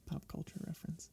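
soft unvoiced mutter; whispered aside. Real, not acted.

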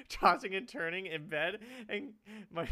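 ordinary delivery

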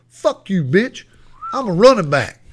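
imitating a kid cussing out the coach; defiant cussing. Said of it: "cussing this goddamn coach like a grown-ass man".

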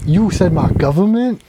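high, incredulous imitation; retold mock indignation. Host retells Chicklet hearing his real name, high mock outrage. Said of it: "He was like".

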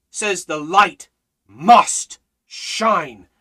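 normal talking voice

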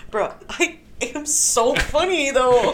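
high, drawn-out boast through giggles; giggly, stretched-out mock bragging; laughing self-praise. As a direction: insist on it slowly while cracking up. Acting out own catchphrase while laughing.

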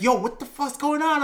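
high-pitched bewildered outcry; retold alarm. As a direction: imitate a startled crowd demanding answers. Everyone reacting to his panic.

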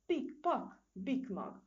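high, loud squirrel war cry; joyful whoop. A narrator's reading. Squirrel hits on a plan, shouting for joy. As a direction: whoop it out with glee. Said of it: "rikkantotta Misi mókus örömkiáltását".

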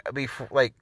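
usual speaking voice

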